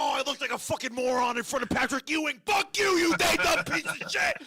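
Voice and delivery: high, loud hammed-up tirade; angry. Comic acting out yelling at random audience members, mock furious shout. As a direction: rage at them at full shout, pitch way up. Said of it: "so angry about celebrities".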